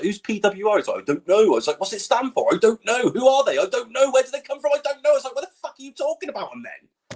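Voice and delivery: high-pitched mock back-and-forth, pitch climbing; strained, high comic frustration; exasperated retold exchange. Retelling his exasperating question-and-answer exchange.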